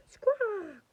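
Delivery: sharp high bird squawk. Acted.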